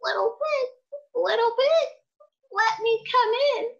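high bleating sheep voice; wolf faking a sweet sheep; sheep disguise voice. Acted.

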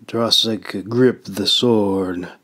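ordinary delivery

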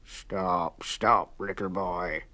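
low, sly growl; low sly fox growl. A narrator's reading. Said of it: "growled the fox".

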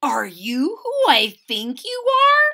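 put-on a boy asking in awe; Chester, amazed, asking the cat. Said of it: "Chester asked the cat".

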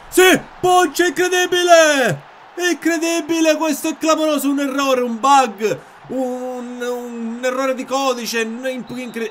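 screaming, very high goal celebration; ecstatic goal shout. Real, not acted. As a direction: explode with joy at the goal. (urlo da gol esultante)

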